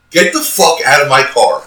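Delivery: loud, high angry yell; retold furious yell; yelling at her. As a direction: throw her out of the car. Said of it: "all I could muster up was".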